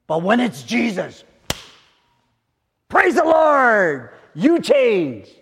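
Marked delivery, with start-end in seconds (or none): 2.9-5.3 sudden loud, slow high shout; shouted praise. Real, not acted. Preacher to congregation, shouting praise the Lord. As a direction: cry out the praise.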